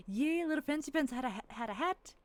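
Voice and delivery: cute squeal. Real, not acted. Streamer cooing at cute rat creatures, squealing. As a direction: coo over the tiny rat people.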